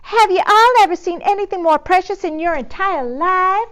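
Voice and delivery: high, loud gushing Texas drawl; Texan drawl. Acted. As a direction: gush like a Houston society lady. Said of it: "drawled Eugenia".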